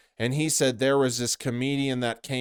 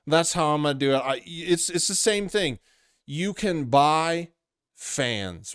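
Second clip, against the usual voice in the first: ordinary talk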